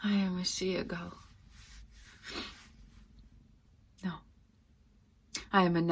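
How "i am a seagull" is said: soft, subdued statement. Acted. Actress naming herself a seagull, subdued and soft. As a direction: say it softly, almost to yourself. Monologue, first of two contrasting takes.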